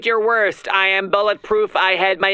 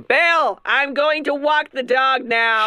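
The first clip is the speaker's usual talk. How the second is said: Hillary Clinton voice; 5 semitones higher than usual. Acted. Hillary calling to Bill.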